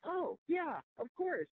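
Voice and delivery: high, casual "oh yeah"; breezy agreement. Retold or imitated. Board members shrug off her claim, light and high. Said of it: "They were like".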